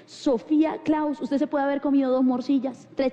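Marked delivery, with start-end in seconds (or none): 0.0-1.2 very slow, reverent name reveal; drawn-out, grand announcement; glamorous awe. Shown as a demonstration. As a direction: savour the name like luxury. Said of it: "Ese nombre es poderoso".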